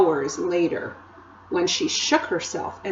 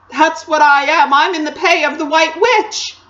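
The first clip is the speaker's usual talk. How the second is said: loud, higher anguished faun; wailed confession; 7 semitones higher, 8 dB louder than usual. A narrator's reading. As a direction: confess in a rising wail. Faun wails his confession.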